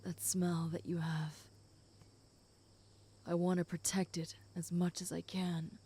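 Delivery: soft breathy whisper, close to the ear. Acted. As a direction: hush it, intimate and close. Whispered intimate roleplay.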